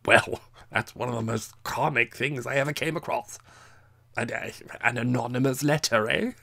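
loud, tipsy chuckling voice; relishing the joke, half drunk; drunken amusement. A narrator's reading. Drunk Captain mocking the letter, amused and loud.

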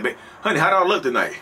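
eager partner. Retold or imitated. Partner fishing for compliment.

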